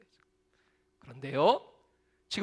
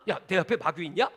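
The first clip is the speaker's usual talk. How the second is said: usual speaking voice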